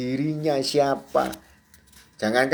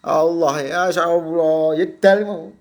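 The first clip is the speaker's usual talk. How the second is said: slow, whiny crying plea to God; a baby's slow tearful cry; mock crying prayer; slower than usual. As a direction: act a helpless newborn wailing to Allah. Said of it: "ya nangis kok".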